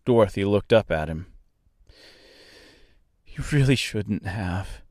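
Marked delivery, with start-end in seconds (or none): 3.2-4.8 soft, worried remark; understated worry, kept quiet. A narrator's reading. Dorothy to the kindly stork after landing, quietly worried. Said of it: "she said in a worried voice".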